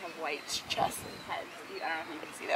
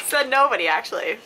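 sarcastic jab; 5 semitones higher, 12 dB louder than usual. Real, not acted. Teasing partner's shirt claim.

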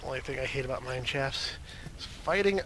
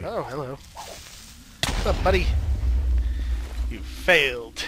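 mocking taunt; 8 dB louder than usual. Taunting a mob.

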